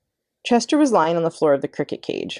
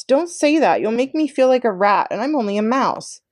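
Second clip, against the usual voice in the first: pleading wail; 7 semitones higher than usual. A narrator's reading. Tucker pleads with despairing Chester, wailing. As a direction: beg him to take it back. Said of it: "wailed Tucker Mouse".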